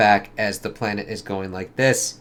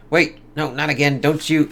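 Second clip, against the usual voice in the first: higher, louder panicked plea; mock panic, raised and loud; panicked cry; 6 semitones higher, 5 dB louder than usual. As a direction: cry out in alarm as you're frozen. Character frozen, panicking.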